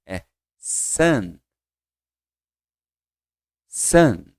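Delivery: son, like Portuguese sã; model pronunciation. Shown as a demonstration. Teacher models son for students. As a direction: say son with an open nasal vowel. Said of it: "Não é som".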